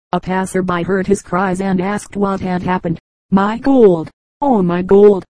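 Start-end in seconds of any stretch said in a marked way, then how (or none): 3.3-5.2 louder, narrated wail of dismay; wild cry. A narrator's reading. Robbed miser to a passerby, a mildly wild cry of grief. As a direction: cry out in despair, a little hammed-up. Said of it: "cried the miser, wildly".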